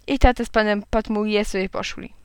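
ordinary delivery, nothing marked

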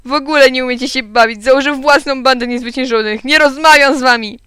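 high, loud, offended tirade; upset outburst. A narrator's reading. Gottfried quitting on his friends, loudly upset. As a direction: storm off verbally, pitch and volume up.

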